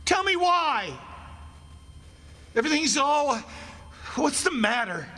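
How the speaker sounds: very high, panicked questions; frantic demand. Acted. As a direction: beg for an answer in rising dread.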